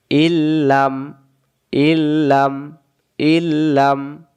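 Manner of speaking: slow ইল্লাম with held ল্ল; doubled lam. Shown as a demonstration. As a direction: draw out ইল্লাম slowly. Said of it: "তাসদিদ ধরে গুন্না সারা".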